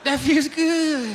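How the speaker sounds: high, drawn-out ecstatic squeal; orgasmic moan. Acted. Man answering condolences about his dad mid-orgasm, high ecstatic moan. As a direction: stretch it out in helpless high-pitched pleasure.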